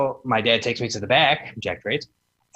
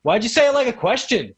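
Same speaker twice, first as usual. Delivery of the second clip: teasing; 8 semitones higher, 3 dB louder than usual. Retold or imitated. Kevin teasing the merch seller.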